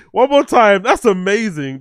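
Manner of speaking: bursting laugh, high and loud; real laughter. Real, not acted. Laughing at the Spanish TTS, asking for a replay, loud and high. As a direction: crack up at the voice. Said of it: "laughing at the TTS".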